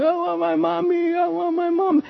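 high, mock wailing children; high crying-child voices. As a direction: imitate a pen full of bawling kids. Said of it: "screaming and crying".

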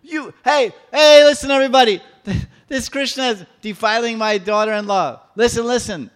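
very high, very loud alarm calls; old woman calling all Vrindavan to listen, shrieking; calling out loudly. Acted. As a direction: rouse the whole village. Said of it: "crying very loudly addressing all the residents".

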